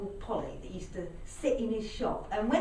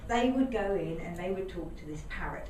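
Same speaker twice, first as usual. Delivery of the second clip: plain reading voice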